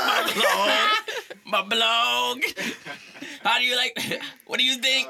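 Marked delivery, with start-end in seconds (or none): 0.1-2.4 "blog" stretched with a laughing rising whine; mocking "blog". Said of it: "say blog like this".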